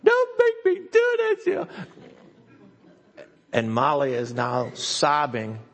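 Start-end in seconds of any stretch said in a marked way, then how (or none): 0.0-1.7 put-on high wailing plea of a scared girl; imitated crying plea. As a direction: wail it like a terrified child.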